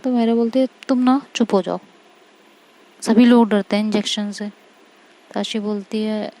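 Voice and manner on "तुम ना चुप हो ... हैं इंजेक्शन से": annoyed retort. A narrator's reading. Annoyed girl snapping back.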